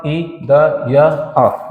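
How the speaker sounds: ई द य अ drawn out very slowly letter by letter; spelled out slowly. Shown as a demonstration. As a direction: spell out Vidya sound by sound. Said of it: "व".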